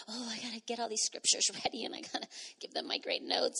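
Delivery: whispered fretting to herself; anxious inner whisper. Retold or imitated. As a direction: whisper the worried thoughts. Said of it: "I have this fight of, like".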